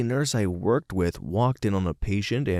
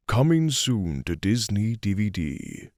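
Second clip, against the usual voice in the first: movie trailer narrator voice. Acted. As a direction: announce it like a film trailer. Trailer voice continued as joke.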